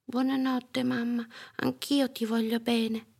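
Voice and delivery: put-on high, gentle child voice; small sleepy-time kid voice; little girl's goodnight. A narrator's reading. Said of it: "disse Molly".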